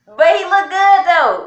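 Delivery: loud, high, hyped-up praise; excited hype. Real, not acted. The dad hypes up the pops look, loud and excited. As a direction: shout it out hyped.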